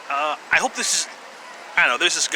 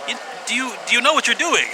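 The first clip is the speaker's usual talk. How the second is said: incredulous; 7 semitones higher, faster than usual. Retold or imitated. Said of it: "I was like".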